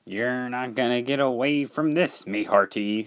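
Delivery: put-on old salt voice; pirate-style sailor. Salty blocking Dart's escape, pirate-style warning. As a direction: warn him off like a pirate.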